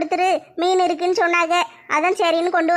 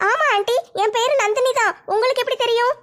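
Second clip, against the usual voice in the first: put-on higher, girlish daughter asking her mother; young daughter voice; 6 semitones higher than usual. Daughter asks mother about the sofa, girlish higher voice. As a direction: play the curious teenage girl, pitched up.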